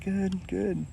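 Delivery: a hint of a shy introvert answering; shy quiet guy. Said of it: "He's like".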